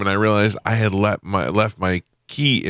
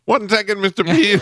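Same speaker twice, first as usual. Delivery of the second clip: high, eager mock reply; eager helper voice; 12 semitones higher than usual. The helper answering Pete's call, high and eager. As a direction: pop up eager to help. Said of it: "And he goes".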